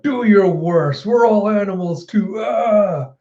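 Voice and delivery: high, drawn-out mock war cry; retold defiant battle shout. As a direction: call it out in defiance, stretching the words.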